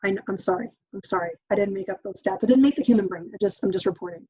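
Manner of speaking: normal talking voice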